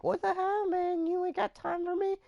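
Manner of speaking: high squeaky put-on whine; mock whiny Eli. Friend complaining to cousin on the phone, high whiny voice. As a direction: whine like a neglected kid.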